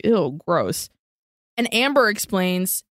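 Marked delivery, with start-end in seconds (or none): 0.1-0.9 grossed out. Real, not acted. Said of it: "And I was like".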